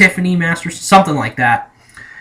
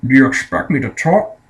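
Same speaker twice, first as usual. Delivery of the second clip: a hint of a suave Scottish spy; lightly voiced Bond, questioning rise; Sean Connery impression; 3 semitones lower than usual. Reviewer quoting Bond's famous line, light Connery impression. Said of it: "And Sean Connery goes".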